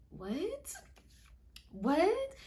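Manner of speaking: high, rising question; pause, then ask in mock bafflement; acted disbelief. Storyteller baffled by the jail rule, high mock disbelief.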